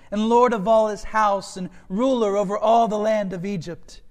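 impassioned scripture reading. A narrator's reading. Reads Joseph's speech from scripture.

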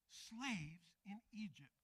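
normal talking voice

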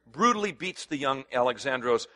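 ordinary talk

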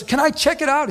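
high-pitched eager question; excited request. Retold or imitated. Stranger asks pastor to see the church, excited. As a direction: ask as if thrilled to see it. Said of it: "and excited".

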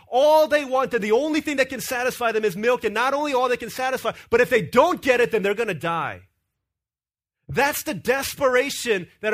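fervent sermon climax; preaching. Acted. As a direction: drive the point home from the pulpit. Preaching at full force.

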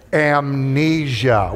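"Amnesia" stretched very slowly; slow, drawn-out. Shown as a demonstration. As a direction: draw out "Amnesia" syllable by syllable. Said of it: "go ahead, hold it up".

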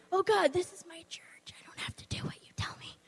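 hushed unvoiced whisper, half to herself. Speaker mimicking her excuse to God, hushed whisper.

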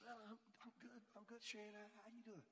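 very soft, halting small talk; hesitant reply. Retold or imitated. Frustrated man greeted by a leader under the tree, hesitant murmur. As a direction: answer barely aloud, reluctant to chat.

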